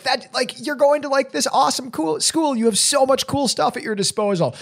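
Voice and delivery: high-pitched envious gush; jealous gushing. Retold or imitated. Teacher to college-bound students, jealous and high-pitched. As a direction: gush enviously, pitched well above normal. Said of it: "I get kinda jealous of 'em".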